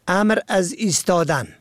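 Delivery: usual speaking voice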